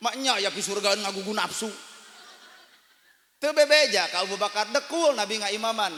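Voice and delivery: normal talking voice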